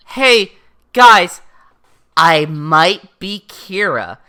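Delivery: loud, high opening, slowing right down; loud announcement. Acted. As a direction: announce it haltingly, word by word. Light announces himself to the others.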